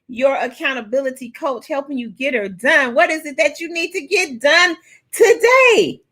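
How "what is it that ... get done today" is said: high, punchy New York accent. Real, not acted. Coach asks what needs doing, New York accent coming out. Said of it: "That's my New Yorker accent coming out".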